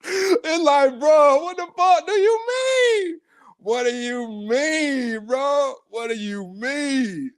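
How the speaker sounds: cracked, high, disbelieving; incredulous. Real, not acted. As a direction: stretch it out in disbelief. Host mocking, incredulous.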